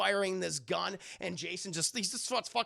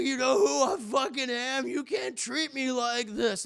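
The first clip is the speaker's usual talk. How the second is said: loud, slower outraged put-on voice; indignant fat thug; 6 semitones higher, 4 dB louder than usual. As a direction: demand respect from the captors. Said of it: "And he's like".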